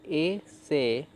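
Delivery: "ए से" drawn out slowly, a gap between ए and से; syllable by syllable. Shown as a demonstration. Teacher building the word for learners, "ए से" sounded out slowly. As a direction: split "ए से" into two long, separate sounds. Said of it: "तो स में ए जुड़ गया".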